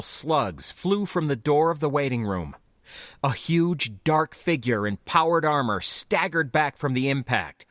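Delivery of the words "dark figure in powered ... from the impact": usual speaking voice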